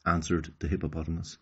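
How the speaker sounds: ordinary delivery, nothing marked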